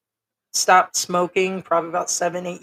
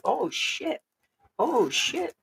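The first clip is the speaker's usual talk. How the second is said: high, hushed "oh shit" with swooping pitch; retold stunned reaction; 7 semitones higher, 5 dB quieter, much slower than usual. Onlooker reacting as George cusses out a troll, mock stunned. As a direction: imitate an awed, soft high exclamation.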